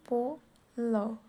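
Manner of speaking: very slow, syllable by syllable: पो ... ल; drawn-out teaching sound-out; split into sounds. Shown as a demonstration. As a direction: stretch पो and ल apart to show the sounds. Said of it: "पॉल कैसे लिखेंगे हम?".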